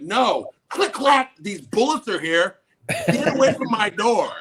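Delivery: loud, high panicked warning; threatening shout. Retold or imitated. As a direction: yell a threat through a closed door. Armed guest threatens man at door.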